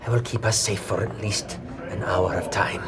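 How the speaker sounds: whispered in-character vow. Acted. Cleric speaks in character.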